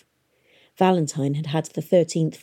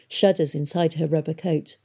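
usual speaking voice

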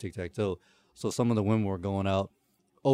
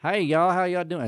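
put-on Southern lady accent; 7 semitones higher than usual. Imitating the accent specialist greeting people, Southern lady accent. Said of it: "talking like a lady from Mississippi or Alabama".

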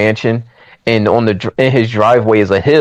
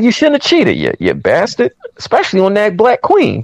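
retold indignation; 9 semitones higher than usual. Viewer scolding the film's cheater for betraying his wife, indignant. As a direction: tell him off in mock outrage. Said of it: "I was getting mad".

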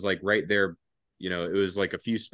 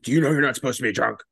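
stern sergeant; 4 semitones higher, 5 dB louder, faster than usual. Retold or imitated. Sergeant scolding him.

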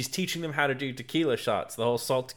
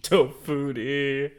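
savoring; much slower than usual. Retold or imitated. Host savoring the name.

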